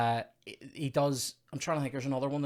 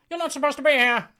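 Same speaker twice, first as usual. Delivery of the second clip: high, loud, snide telling-off; officious mock rebuke; snidey crewman; 10 dB louder than usual. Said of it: "the snidey guy".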